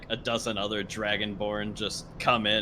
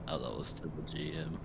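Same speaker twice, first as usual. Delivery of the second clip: breathy whisper, much softer, a quick out-of-character aside; 6 semitones lower, 15 dB quieter than usual. Real, not acted. Player whispers to the GM, an unvoiced aside. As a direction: whisper it so only the table hears.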